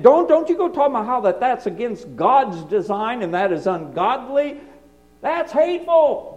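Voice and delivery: high, loud mocking scold; lightly voiced accusing critic. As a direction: mimic the shrill accusers. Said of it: "mocking accusers' voice".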